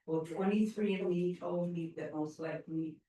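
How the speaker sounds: ordinary delivery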